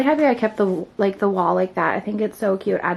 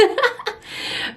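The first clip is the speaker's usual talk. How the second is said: airy giggle, pitched up high; high breathy laugh; 4 dB quieter than usual. Real, not acted. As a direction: let out a light breathless laugh. Laughs after explaining breathlessness.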